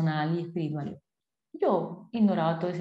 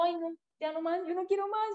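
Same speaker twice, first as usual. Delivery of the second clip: much higher, whining "no more"; a tired mother whining she can't take more; whiny refusal; 10 semitones higher, 4 dB quieter than usual. Retold or imitated. Mother tired of rushed meals, whining high. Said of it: "y yo".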